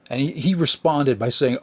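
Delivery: ordinary delivery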